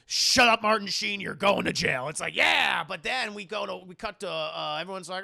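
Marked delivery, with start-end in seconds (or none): 0.1-2.1 acted angry rebuke. 2.4-2.8 loud, high whoop of approval; acted cheer. Acted cheer at villain's defeat.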